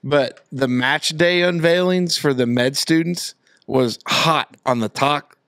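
normal talking voice

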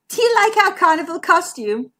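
high, lightly giggly question. A narrator's reading. Friend shows off the carnival costume, giggling. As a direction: ask it with a hint of a giggle. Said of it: "giggled Macmere".

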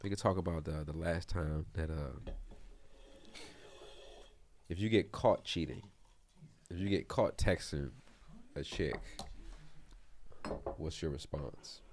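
low, very soft, halting question; vulnerable hush. Pretend. Host asking friends about getting caught cheating, hushed. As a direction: pose it deep and hushed, with long pauses. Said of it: "I'm whispering to be vulnerable".

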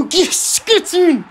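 high, loud yelp of joy; real ecstatic yell; goal celebration. Real, not acted. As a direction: cheer the goal as it goes in. His team scores.

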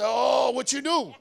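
loud, half-laughing fatherly demand; a father pressing his child, playfully; daddy grilling the kids, half-laughing; dad confronting child. Retold or imitated. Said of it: "I say".

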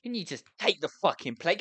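normal talking voice

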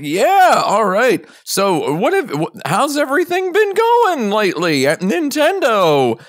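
high, loud chirpy greeting; bright high fan voice; eager fan voice. Retold or imitated. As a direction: overeager small talk. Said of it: "I was like".

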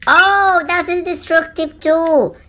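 high, loud burst of delight; excited cheering. Real, not acted. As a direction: celebrate a lucky find, voice soaring. Excited reaction during gameplay.

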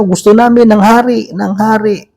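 a crowd demanding a king, lightly voiced; retold crowd shout. Israelites demanding a king from Samuel, mock shouting.

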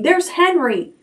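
high excited call; excited cry. A narrator's reading. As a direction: point him out eagerly. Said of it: "cried Fern".

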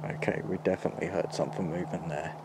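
fast, hushed and tense; nervous night vlog whisper. Real, not acted. Hears noise in quiet forest.

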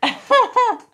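loud, high laugh; real laugh bursting out at a tease; burst of laughter. Real, not acted. Laughing at partner calling her crazy, loud and high.

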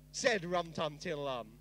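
ordinary delivery

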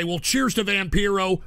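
ordinary delivery

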